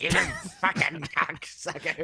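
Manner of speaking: put-on slow, drawn-out old man's curse with a laugh; a lightly voiced grumpy old man; old man cursing. Teller laughing while imitating the old customer, slow. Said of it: "an old ass man that's just like".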